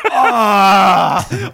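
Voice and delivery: loud mock groan of pain. Alone after the kid's punch, loud groan. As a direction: groan as if just hit. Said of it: "wie ich da vor mich hin stöhne".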